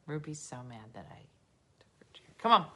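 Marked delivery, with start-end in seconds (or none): none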